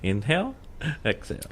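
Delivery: soft, coaching her to breathe; soft breathing coach guiding a friend; calming breath cues. Shown as a demonstration. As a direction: talk her down gently, inhale exhale.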